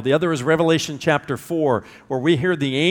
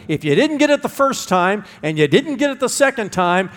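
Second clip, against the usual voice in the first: ordinary delivery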